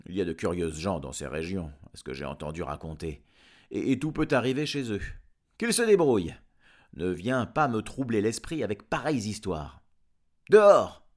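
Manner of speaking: fast, then loud shouted dismissal; gruff farmer Giles. Acted. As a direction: brush it off and chase him out. (Gilles bourru, rapide puis crie dehors)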